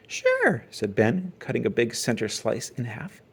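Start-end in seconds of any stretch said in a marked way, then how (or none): 0.1-0.6 high folksy farmer voice; a wise old farmer advising. A narrator's reading. Farmer Ben voice.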